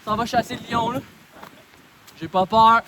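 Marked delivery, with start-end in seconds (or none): 2.2-2.8 loud shout. Real, not acted. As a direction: yell it out. Shouting on the mountain.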